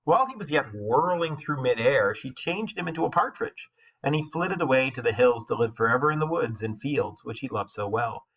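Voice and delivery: usual speaking voice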